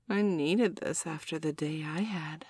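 ordinary talk, nothing marked

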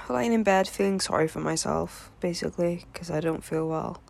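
ordinary delivery

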